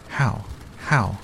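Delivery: Mary cries out eagerly to the gardener; eager cry. Acted. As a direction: burst out eagerly, forgetting caution. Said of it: "cried Mary".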